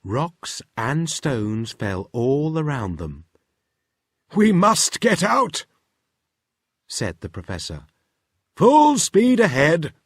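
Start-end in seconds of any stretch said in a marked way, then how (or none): 4.3-9.9 high, loud commands; urgent Professor Tangle. Acted. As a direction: urge the sub to flee. Professor orders escape.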